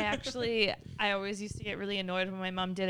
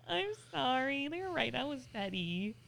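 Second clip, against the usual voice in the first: sheepish apology; 5 semitones higher, 4 dB quieter than usual. Retold or imitated. Acted apology to partner.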